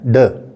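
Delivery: a slow, drawn-out ड, the d sound of delta; teacher demonstrating the letter sound. Shown as a demonstration. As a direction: hold the ड long as a teaching sound. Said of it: "वही delta की आवाज़ है".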